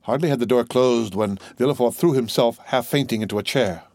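ordinary delivery, nothing marked

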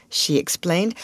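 plain reading voice

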